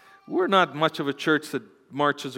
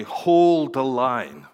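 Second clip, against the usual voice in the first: drawn-out, louder battle command; general to his army; 5 dB louder, much slower than usual. Retold or imitated. General telling his army to hold, long commanding call. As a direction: stretch it out as an order to troops. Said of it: "he's the general leading the army".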